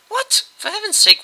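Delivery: high, loud exclaim of surprise; shocked outburst. A narrator's reading. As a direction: yelp in disbelief. Bastings shocked by plan.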